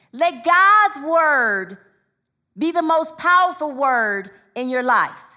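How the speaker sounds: rousing sermon appeal; preaching exhortation. Real, not acted. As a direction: urge the congregation on with weighty key words. Exhorts the congregation.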